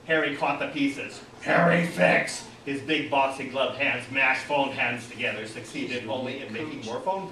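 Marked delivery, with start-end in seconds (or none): 1.4-2.5 slow, raised, loud caveman voice; brutish exclamation. A narrator's reading. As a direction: declare it like a slow, simple brute. Brute Harry tries fixing phone.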